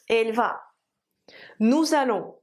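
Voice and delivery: ordinary talk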